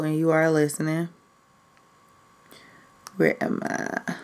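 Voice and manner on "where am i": slow whisper to herself; whispered to self. Real, not acted. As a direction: whisper while searching. Searching for the school name.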